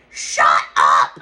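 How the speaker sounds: loud, imitated shriek of disbelief; imitating her shocked yell; surprised scream. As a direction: blurt it out at full volume, stunned. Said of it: "she screams".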